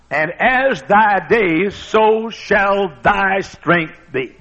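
stretched, solemn verse; slow scripture reading. Acted. Preacher quoting the scripture, slow and solemn. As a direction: intone the verse slowly.

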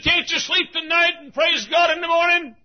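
high, loud exasperated shout; neighbour's shout. Retold or imitated. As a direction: yell it through the wall. Neighbour shouting complaint retold.

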